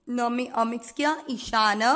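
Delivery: ordinary talk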